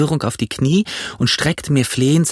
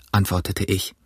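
plain reading voice

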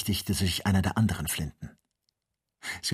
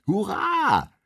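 very high jubilant cheer; shouting; 7 dB louder, much slower than usual. A narrator's reading. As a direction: yell a drawn-out hurrah in triumph. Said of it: "schrie Joe". (lauter, hoher Jubelschrei)

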